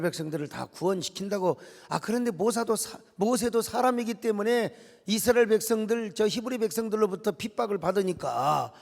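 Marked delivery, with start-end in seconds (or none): none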